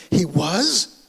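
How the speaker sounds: imitating her surprised question; mildly astonished, retelling her reaction.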